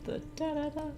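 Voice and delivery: usual speaking voice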